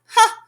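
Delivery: high scornful bark of a laugh; mocking ha. A narrator's reading. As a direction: spit out a jeering ha. Wickersham taunt.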